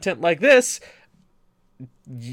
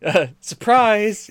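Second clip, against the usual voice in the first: excited; 8 semitones higher, 9 dB louder, much slower than usual. Real, not acted. Gag reveal of real name.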